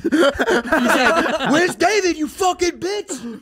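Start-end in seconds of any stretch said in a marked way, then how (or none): 1.5-3.4 high, loud mock demand; imitated belligerent yelling; aggressive fan. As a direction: holler it like a hostile admirer, dragging the insult. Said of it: "but also aggressive".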